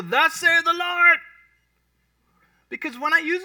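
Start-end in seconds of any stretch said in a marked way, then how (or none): 0.0-1.2 slow, loud, booming pronouncement; over-the-top King James prophet; mock grand prophet. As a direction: intone it like an infallible oracle. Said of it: "I'm not gonna go".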